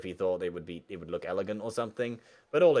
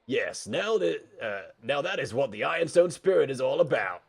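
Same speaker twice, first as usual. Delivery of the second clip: raised, emphatic podium voice; higher, louder student-leader oratory; class president's rally speech; 6 semitones higher, 6 dB louder than usual. Acted. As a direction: proudly address the cheering school.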